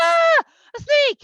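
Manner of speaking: high, loud mock scream; panicked scream. Moshe seeing his stick become a snake, panicked. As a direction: shriek in hammed-up fright.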